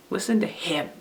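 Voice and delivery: soft line landing on "him"; stress on HIM. Shown as a demonstration. Teacher recapping the three readings for children, stress on "him". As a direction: hold the weight back for "him". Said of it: "when you stress a different word".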